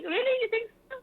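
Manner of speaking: high-pitched, delighted disbelief; acted surprise. Host retelling her reply to her PR, mock surprised. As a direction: replay being flattered and amazed, voice way up.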